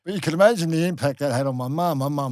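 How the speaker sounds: loud, laughing insistence that it never happened; half-laughing loud protest; emphatic denial. Real, not acted. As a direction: raise your voice and laugh it off, insisting hard. Insisting the rumour was false.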